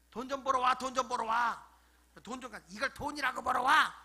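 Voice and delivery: loud, insistent mock scolding; nagging wife. Wife demanding her husband earn money, loud nagging. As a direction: hammer it out like a fed-up wife. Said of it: "그게 되게 여자들 매 맞는 게".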